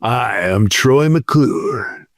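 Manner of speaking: low, slow TV host voice; Troy McClure impression.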